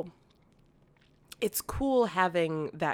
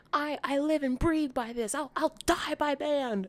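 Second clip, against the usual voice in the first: devoted band geek; 9 semitones higher than usual. Retold or imitated. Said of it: "where it's just like".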